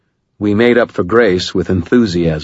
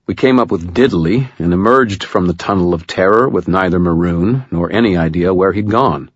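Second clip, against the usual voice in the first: plain reading voice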